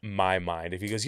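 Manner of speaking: ordinary delivery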